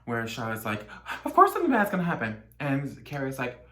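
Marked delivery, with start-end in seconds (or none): 1.2-2.4 high, loud, rapid wail; hammed-up upset movie quote; Charlotte. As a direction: blurt it out in mock dismay.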